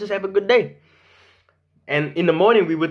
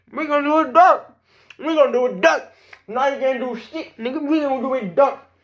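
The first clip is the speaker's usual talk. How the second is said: high silly voice; 10 semitones higher than usual. Pretend. Silly chant.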